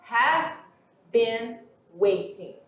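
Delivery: slow, each word set apart with pauses. Shown as a demonstration. Teacher breaking the structure into single words, slow and separate. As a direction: lay out have, been, waiting one at a time. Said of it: "teacher spells out structure".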